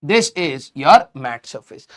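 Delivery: each word drawn out slowly; slow emphatic teacher dictation. Shown as a demonstration. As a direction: spell it out word by word for the class. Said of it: "ये वाला surface".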